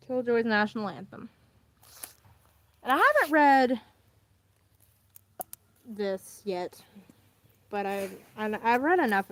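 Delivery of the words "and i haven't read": excited. Real, not acted. Excited about the comic.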